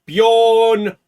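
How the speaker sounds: slow, drawn-out winner's name; ceremonial announcement. Pretend. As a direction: announce the winner like a gala host.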